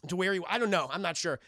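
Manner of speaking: ordinary talk, nothing marked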